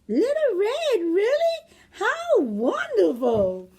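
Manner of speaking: put-on very high, loud, slow old lady voice; delighted grandmother. Grandmother welcomes her visitor, very high and delighted. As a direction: coo with delight like a granny.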